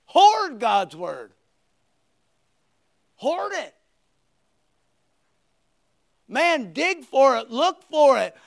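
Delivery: loud, high, driving sermon delivery; full-force preaching. Acted. Pastor exhorting the congregation to hoard God's word, loud. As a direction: preach it out to the back row.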